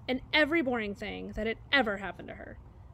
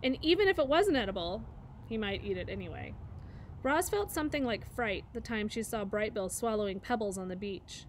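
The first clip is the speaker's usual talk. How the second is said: ordinary delivery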